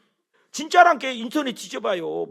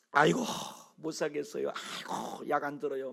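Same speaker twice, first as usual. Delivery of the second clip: grumbling patient; 5 semitones lower, 8 dB quieter than usual. Retold or imitated. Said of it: "막 짜증내는 사람은요".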